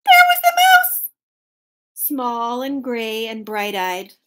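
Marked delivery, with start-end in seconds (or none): 0.0-1.0 very high, loud squeal of surprise; high-pitched astonished narration; shocked discovery. A narrator's reading. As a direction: shriek the surprise to young listeners.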